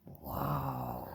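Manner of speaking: usual speaking voice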